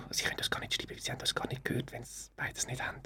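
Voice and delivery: hushed whisper aside. Real, not acted. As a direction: drop to a whisper for the joke. Joking aside, drops to whisper.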